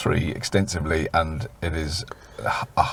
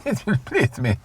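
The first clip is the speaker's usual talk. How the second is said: put-on light higher Spanish accent; 5 semitones higher, 4 dB quieter than usual. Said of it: "in your finest Spanish".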